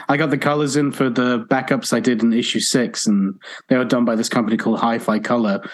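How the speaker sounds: ordinary delivery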